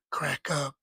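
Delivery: normal talking voice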